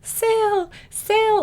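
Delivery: high desperate calling out; screaming his name. Retold or imitated. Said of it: "she starts just screaming his name".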